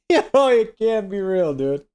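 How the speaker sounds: high laughing burst of disbelief. Real, not acted. Streamer reading a viewer's comment, cracking up in real laughter. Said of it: "I'm crying right now".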